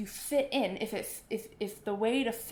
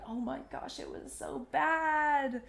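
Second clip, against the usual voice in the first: higher, slow, drawn-out mock despair; dramatic post-show groan; 5 semitones higher, slower than usual. Musician coming off stage, hammed-up slow moan. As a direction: moan it like you bombed on stage. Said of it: "musicians coming off stage going".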